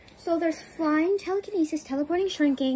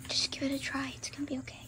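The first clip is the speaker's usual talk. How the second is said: whispering; 10 dB quieter than usual. Pretend. Coaxing friend to try flying.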